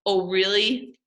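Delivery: plain reading voice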